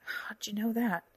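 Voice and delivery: slow, breathy, strained question; pained, half-whispered question; through clenched teeth. A narrator's reading. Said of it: "clenched her teeth and asked".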